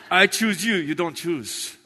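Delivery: normal talking voice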